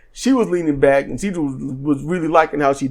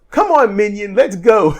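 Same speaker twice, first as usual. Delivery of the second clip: higher mock yell, not full force; retold yell; 5 semitones higher than usual. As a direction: imitate a bossy call to a sidekick. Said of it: "And then Athena yells".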